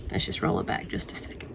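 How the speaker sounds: low, soft creepy whisper. Pretend. Host dropping into a creepy whisper for spooky season. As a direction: hiss it like a haunted storyteller. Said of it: "Creepy voice".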